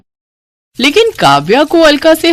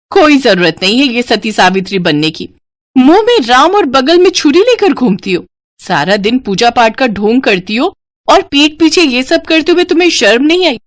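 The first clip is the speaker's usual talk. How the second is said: furious scolding; 5 semitones higher than usual. Acted. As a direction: lash out at the pretend-pious bride. Family scolding the bride. (गुस्से में डांटना)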